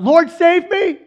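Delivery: high, loud hammed-up cry for help; desperate cry. Sinking Peter cries to Jesus, acted desperate shout. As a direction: cry out like a drowning man. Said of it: "as he's sinking, he cries out".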